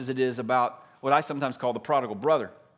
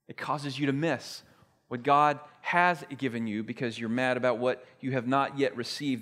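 ordinary talk

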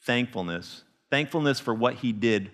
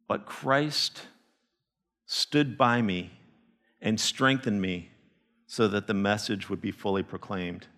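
slow, softer testimony; pastor's quiet personal confession; soft, sincere; 5 semitones lower, 4 dB quieter than usual. Real, not acted. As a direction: lower and slower, speak it from the heart. Repeats verse softly, personal.

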